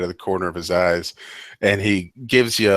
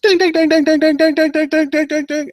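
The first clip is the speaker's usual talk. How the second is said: rapid, high-pitched bell dinging; loud, insistent ding-ding on one high note; imitated bell ringing; 6 dB louder than usual. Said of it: "starts ringing it".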